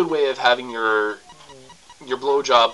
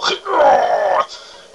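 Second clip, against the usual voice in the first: much higher and louder, a shrill crying shout; shrieking laugh-cry; 9 dB louder than usual. Acted. Asked to use her teeth, a shrieking mock wail. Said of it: "don't sit there and go".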